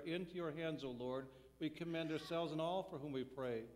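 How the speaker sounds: ordinary talk, nothing marked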